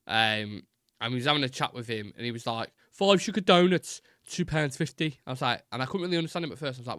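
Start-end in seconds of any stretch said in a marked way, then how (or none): 2.9-5.2 strong Brummie, pitched up and drawn out; exaggerated imitation of a Birmingham vendor; Brummie accent. Donut seller quoting his price to a customer, strong Brummie accent. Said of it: "like, the strongest Brummie accent ever".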